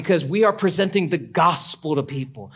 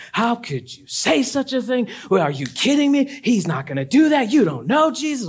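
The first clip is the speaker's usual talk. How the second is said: pitched-up scandalized complaints; mock outrage; 8 semitones higher than usual. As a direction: play offended churchgoers. Voicing upset congregants.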